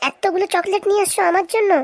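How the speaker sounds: high, gushing delight. Acted. Wife thrilled her husband brought chocolates, high delighted gush. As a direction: squeal over the gift, pitched high.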